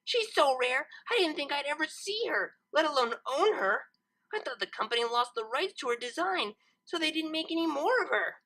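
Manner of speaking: put-on high, awestruck young mare; a devoted collector marveling at a rare treasure; Lyra, awed. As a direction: Lyra tells her friend about a rare toy, awed. Lyra's voice, awed.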